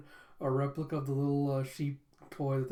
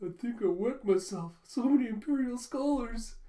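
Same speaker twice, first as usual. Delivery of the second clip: high, rising gush of awe; hammed-up starstruck flunky; overwhelmed awe; 9 semitones higher than usual. Flunky to his friend at seeing the Imperial Scholars, awestruck.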